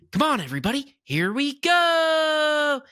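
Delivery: high, slow, stretched out ride announcer call; drawn-out high cheerful call; Peter Pan ride voice. Retold or imitated. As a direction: sing it out like the ride's Peter Pan. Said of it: "why he gets a weird accent".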